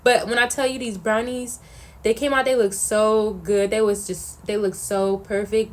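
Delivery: ordinary delivery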